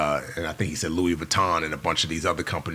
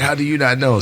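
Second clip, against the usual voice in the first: high, louder mock disbelief; incredulous; 8 semitones higher, 6 dB louder than usual. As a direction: push it up high and loud, baffled. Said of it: "He's like".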